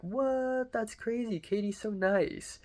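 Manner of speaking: high-pitched retold disbelief; incredulous. Defending Katie to Mitchell in the retelling, incredulous. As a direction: recreate a baffled protest, voice jumping well up.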